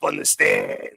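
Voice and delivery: mock mobster defendant. Mocking witness pleading in court.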